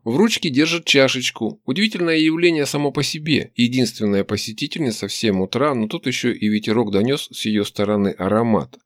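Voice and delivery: ordinary delivery, nothing marked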